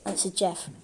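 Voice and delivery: ordinary delivery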